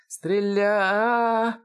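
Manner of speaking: very high word stretched into a scream. A narrator's reading. Shooter scalded by steam mid-order, screaming. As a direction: let the order break into a long high cry.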